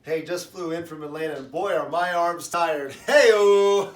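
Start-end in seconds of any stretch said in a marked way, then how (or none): 3.1-3.9 loud, drawn-out "hey-o"; punchline catchphrase. Acted. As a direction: call it out big to cap the joke. Comedian's shouted punchline catchphrase.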